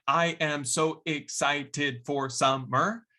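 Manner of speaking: every syllable the same length, x0.6 slow; robotic equal beats; flat even syllables. Shown as a demonstration. Teacher showing the wrong even rhythm, slow and evenly spaced. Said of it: "That's not right at all".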